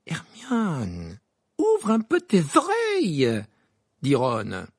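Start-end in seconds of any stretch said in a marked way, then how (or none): 0.1-3.4 rising to a slow, high, drawn-out call; exasperated Ron. A narrator's reading. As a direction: get it through to someone who won't listen. Ron exasperated, trying to get through. (exaspéré, en haussant le ton)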